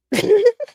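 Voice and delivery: sudden high loud laugh; laughing burst. Real, not acted. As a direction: let out a bright laugh.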